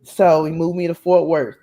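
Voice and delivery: normal talking voice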